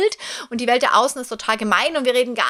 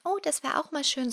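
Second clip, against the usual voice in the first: softer and higher, a timid little inner voice; small inner voice; 6 semitones higher, 7 dB quieter than usual. Retold or imitated. The small inner voice whispering a wish, soft and high. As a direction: let a tiny voice inside pipe up, hushed and light. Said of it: "manchmal ploppt ja so eine kleine Stimme auf".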